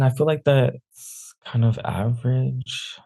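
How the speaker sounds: usual speaking voice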